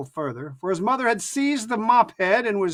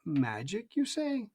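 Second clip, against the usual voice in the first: quieter, unsure; 8 dB quieter, slower than usual. A narrator's reading. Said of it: "his voice was quieter and less confident".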